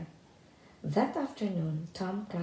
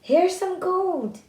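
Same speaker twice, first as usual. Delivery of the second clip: shouting; 12 semitones higher, 10 dB louder, slower than usual. A narrator's reading. Tom shouting to thieves.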